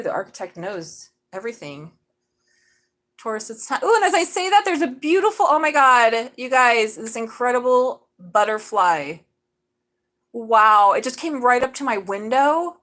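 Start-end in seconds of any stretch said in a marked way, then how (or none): 3.8-12.8 high, loud burst of delight; thrilled squeal at a butterfly landing by the window; excited. Real, not acted. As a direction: gush over a sudden butterfly, pitched up.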